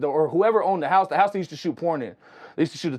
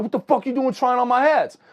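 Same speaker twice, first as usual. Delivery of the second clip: retold yelling; 9 semitones higher than usual. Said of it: "he starts yelling at this fool".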